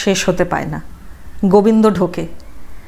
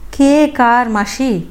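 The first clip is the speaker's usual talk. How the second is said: usual speaking voice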